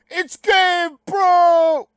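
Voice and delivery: high, very loud, stretched-out holler; hyped-up shout. Acted. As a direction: bellow it like a big announcement, dragging each word. Shouts a familiar name in excitement.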